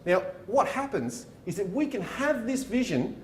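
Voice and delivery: ordinary talk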